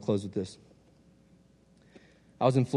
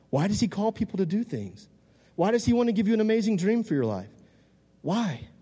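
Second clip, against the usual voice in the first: high-pitched, wondering questions; high rhetorical preacher's question; 8 semitones higher than usual. Real, not acted. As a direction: pose it to the crowd, pitched up high.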